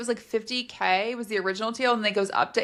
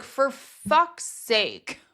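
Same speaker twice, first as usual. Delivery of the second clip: slow, dragged-out fed-up sigh of words; exasperated groan; 4 semitones higher, much slower than usual. Retold or imitated. Listener fed up with the plot, slow exasperated drawl. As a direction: drawl it like you're sick of it. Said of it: "And I was like".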